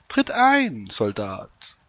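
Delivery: put-on high old woman voice. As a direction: play the old peasant woman.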